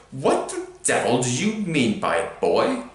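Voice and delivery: croaky voice trembling with rage; croaky trembling fury. A narrator's reading. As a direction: choke out the words in fury. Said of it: "in a croaky voice that trembled with fury".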